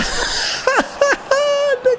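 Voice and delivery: high-pitched burst of laughter; real, squealing laugh. Real, not acted. Player cracking up at own botched shot, high laugh.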